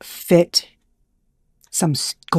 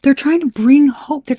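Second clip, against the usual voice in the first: higher, louder excited delivery; 8 semitones higher, 6 dB louder than usual. Real, not acted. Vlogger thrilled by the reading, excited and loud. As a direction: rave about the hope in the cards.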